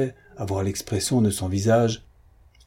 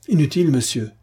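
firm, higher-pitched refusal; firm and calm; 6 semitones higher, 4 dB louder, faster than usual. A narrator's reading. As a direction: refuse him with composed firmness. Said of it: "avec beaucoup de fermeté et un grand calme". (ferme et calme)

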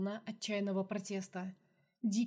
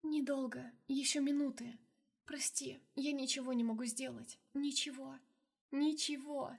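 barely audible; 5 semitones higher than usual. Acted. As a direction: hardly voice the words. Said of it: "едва слышно прошептала".

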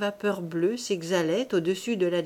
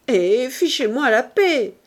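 loud, exasperated snap; angry shout; 3 semitones higher, 8 dB louder than usual. A narrator's reading. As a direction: get rid of the pest, shouting.

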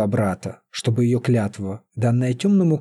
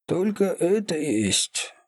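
put-on plaintive old wizard; 5 semitones higher, 4 dB quieter, slower than usual. A narrator's reading. Feodor voiced, plaintive.

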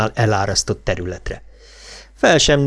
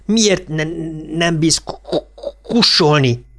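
stuttering; 6 semitones higher than usual. Acted. Bill stutters angrily at Richie.

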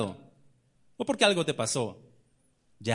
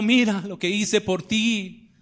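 loud, slow and stretched out; impassioned cry; 6 semitones higher, 7 dB louder, slower than usual. Acted. Christ on the cross calling out his love, loud and slow. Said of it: "te está diciendo".

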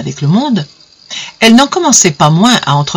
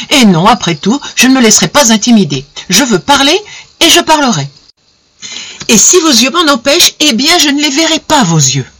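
raised pitch, forceful and determined; defiant resolve; 7 semitones higher than usual. Acted. The suitor refusing to be silenced, forcefully determined. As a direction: steel himself and push on.

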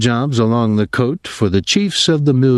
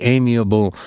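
ordinary delivery, nothing marked

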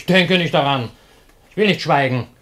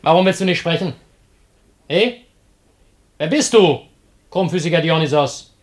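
higher, loud, hectoring; bullying shout; 5 semitones higher, 5 dB louder than usual. Acted. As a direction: yell at the stranger to speak. Slave shouts at silent stranger.

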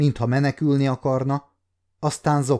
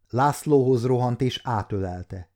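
usual speaking voice

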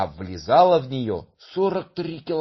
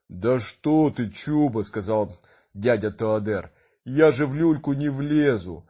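gentle giant voice. Acted. Giant refuses the cradle.

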